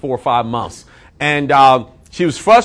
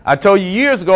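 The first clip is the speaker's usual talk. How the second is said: normal talking voice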